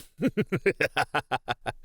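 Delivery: high rippling cackle, trailing down; amused monster laugh. Acted. Creature asked what it is, amused cackle. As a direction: laugh at a foolish question.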